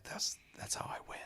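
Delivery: soft whispered excuse; mock sly whisper; whispered shrug. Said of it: "And I'm like".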